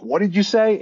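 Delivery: a big high-pitched what did you say; retold rookie calling out in disbelief; mock bewildered rookie asking again; incredulous, pitched high. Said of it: "I was like".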